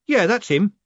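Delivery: loud, high call; shout. A narrator's reading. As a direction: point him out loudly. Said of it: "one of them shouted".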